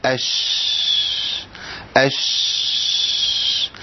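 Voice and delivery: أش with a long hissed sh that fades by itself; أش drawn out, whispered sh; sustained sh demo. Shown as a demonstration. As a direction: hold the sh of أش until it dies out. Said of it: "هنقول إيه؟".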